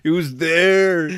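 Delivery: high, loud, drawn-out whine; mock his pathetic excuse; whiny mock excuse. Coworker's whiny excuse mimicked.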